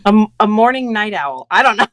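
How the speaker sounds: ordinary talk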